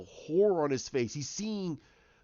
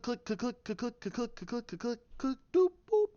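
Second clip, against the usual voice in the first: imitated door clicks; 9 semitones higher than usual. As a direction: click like a lock, then "doop, boop". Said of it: "you hear the door go click".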